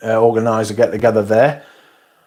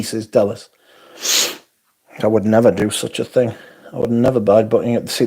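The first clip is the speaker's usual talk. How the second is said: ordinary delivery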